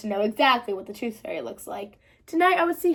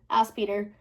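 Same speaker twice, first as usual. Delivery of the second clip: ordinary delivery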